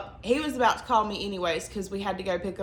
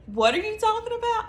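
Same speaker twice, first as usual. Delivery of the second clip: much higher, fast puzzled squawk; imitated baffled husband; 13 semitones higher, 3 dB louder, faster than usual. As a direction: mimic his confused disbelief.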